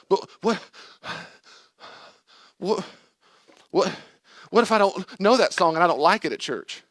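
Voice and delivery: high, loud sputtering protest; stammer, then fret loudly up high; anxious objector. Acted. Anxious churchgoer objecting about a song, high sputtering panic.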